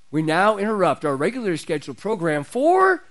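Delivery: normal talking voice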